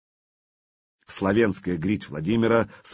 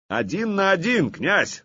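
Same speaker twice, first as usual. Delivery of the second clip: challenging shout; 10 semitones higher than usual. Acted. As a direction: hurl the challenge up at the prince. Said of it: "закричал он".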